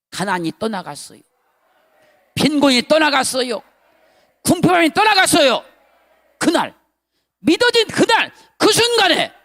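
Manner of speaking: loud, high preacher's cry; fervent preaching. Acted. Pastor retelling his revelation to church, loud fervent preaching. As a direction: shout the revelation with fervor.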